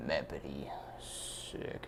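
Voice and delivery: breathy awed whisper; hushed impressed whisper; whispered sick. Real, not acted. Quiet awe at art.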